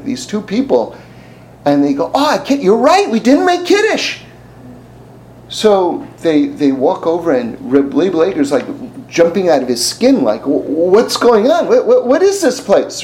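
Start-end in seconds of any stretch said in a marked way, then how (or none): 2.1-4.2 raised, high-pitched voice for the two men; high mock voices. Two Kotsk men talk, voiced. 10.4-13.0 slow, high, laughing bewilderment; startled. Retold or imitated. Startled rabbi voiced.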